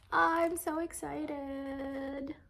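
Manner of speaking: slow, high drawn-out squeal; real excitement. Real, not acted. Vlogger thrilled over her haul, high excited squeal. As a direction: stretch every word high with delight. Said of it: "That's how excited I am".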